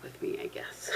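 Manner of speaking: barely whispered aside. Real, not acted. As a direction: whisper it to yourself. Trailing off, unsure.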